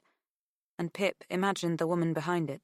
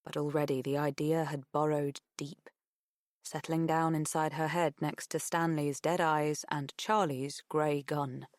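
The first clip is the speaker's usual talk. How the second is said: ordinary delivery, nothing marked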